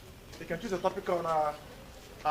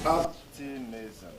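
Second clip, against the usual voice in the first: optimism slow, up on first syllable then falling down; stress tune demo; 3 semitones lower, much slower than usual. Shown as a demonstration. Teacher showing the stress tune to the class, slow. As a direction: show the up-down-down tune. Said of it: "Up, down, down".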